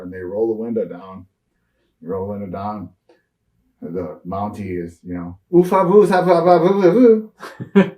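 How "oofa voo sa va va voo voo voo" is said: louder, much higher mock French gibberish. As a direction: babble fake French like a Quebec Mountie. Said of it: "Because we're up in, uh, Quebec".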